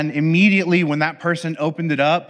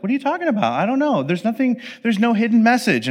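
higher, mock-innocent denial; feigned innocence; 6 semitones higher, faster than usual. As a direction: play dumb, all wide-eyed. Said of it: "the giver just pretends".

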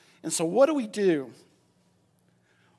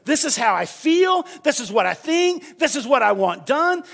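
loud, higher, venting prayer; mad; 5 semitones higher, 4 dB louder than usual. Retold or imitated. Nehemiah telling God exactly how he feels, heated. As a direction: say it like you're ticked off. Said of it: "he's mad. He's ticked off".

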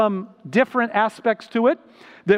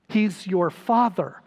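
normal talking voice

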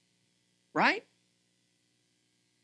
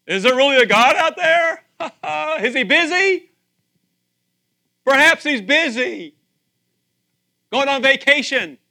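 mocking onlookers; 9 semitones higher, 8 dB louder than usual. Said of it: "everybody around me be like".

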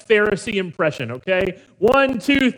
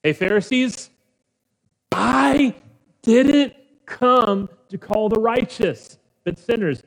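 louder and higher, slow on didn't come; preacher calling out the Pharisees loudly; loud proclamation; 6 semitones higher, 7 dB louder than usual. Acted. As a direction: call it out across the room to the Pharisees. Repeats verse aloud to Pharisees.

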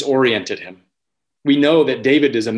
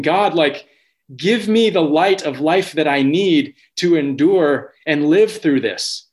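impassioned prayer; 5 semitones higher than usual. Retold or imitated. Voicing David pleading with God amid enemies, impassioned. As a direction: pray for David like a man pressed on every side.

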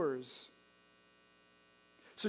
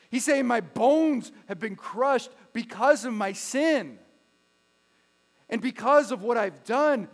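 louder preaching on the crushed bones, slowing down; preaching at full force; 3 semitones higher, 10 dB louder than usual. Acted. Preacher voicing David's longing to the church, loud and urgent. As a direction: drive the psalm home to the congregation.

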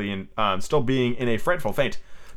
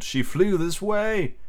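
high, drawn-out troubled statement; becoming troubled; 7 semitones higher, much slower than usual. A narrator's reading. Peter searching for Wendy among the boys, troubled. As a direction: stretch it out in a grand put-on voice. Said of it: "becoming troubled".

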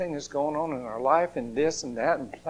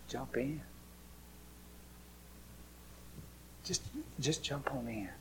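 soft, whispered reassurance, much softer; gentle whisper from God; 20 dB quieter than usual. Acted. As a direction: lean in and whisper the promise like a loving father. God inviting softly.

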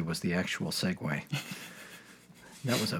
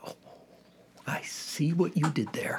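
whispering; 6 semitones higher than usual. Real, not acted. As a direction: lean in and whisper it knowingly. Teasing aside about the segue.

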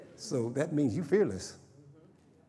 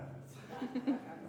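high breathy laugh; 12 semitones higher, 10 dB quieter than usual. Real, not acted. Preacher laughs at denial.

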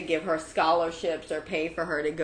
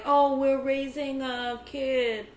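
slow, drawn-out wealthy socialite voice; rich snob; 4 semitones higher, slower than usual. Retold or imitated. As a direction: lazily put on a pampered rich parent. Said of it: "You did a great impression of a, of a wealthy family".

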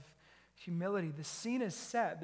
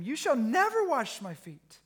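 NEVER rises high and loud; emphatic refusal; 7 semitones higher, 9 dB louder than usual. Acted. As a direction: refuse firmly, voice lifting sharply. Peter refuses Jesus in reading.

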